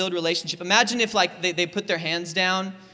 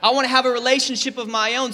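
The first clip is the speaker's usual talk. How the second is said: higher, louder pleading of a lonely single; longing whine; 4 semitones higher, 4 dB louder than usual. Retold or imitated. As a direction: whine it like you're desperate for love. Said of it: "you're like".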